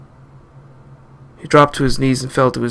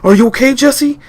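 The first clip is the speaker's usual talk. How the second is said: anxious calling; 10 semitones higher than usual. Acted. People calling to wounded man.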